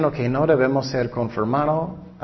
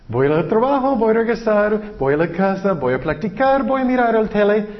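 higher, louder sing-song mockery of an empty life; mocking routine; 7 semitones higher, 4 dB louder than usual. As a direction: parody a pointless weekly routine, grinning. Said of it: "Qué ridículo". (burla cantarina, más aguda y fuerte)

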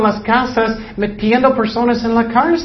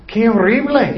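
ordinary talk

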